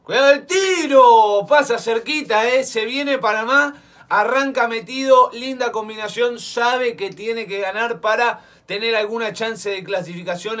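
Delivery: high, loud fast commentary; excited play-by-play. Real, not acted. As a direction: call the attack live. Live play-by-play of a shot. (relato encendido de la jugada)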